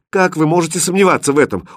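high, quick reproach; hurt, hurried rebuke; reproachful. A narrator's reading. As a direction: protest at being doubted. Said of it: "упрекнула его девушка".

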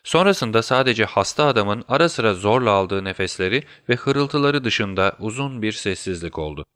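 plain reading voice

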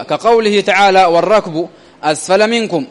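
normal talking voice

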